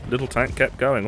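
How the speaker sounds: normal talking voice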